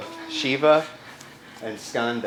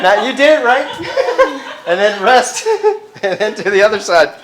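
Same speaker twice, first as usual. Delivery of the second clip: laughing; 11 semitones higher, 10 dB louder than usual. Real, not acted. Still laughing while cueing.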